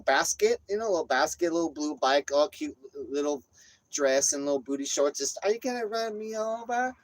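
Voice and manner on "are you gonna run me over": retold yelling. Woman in booty shorts circling on her bike, mock yelling. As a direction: wail it out high, imitating her. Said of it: "Yelling though".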